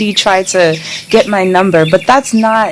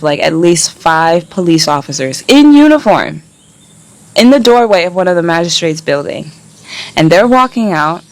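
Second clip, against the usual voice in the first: usual speaking voice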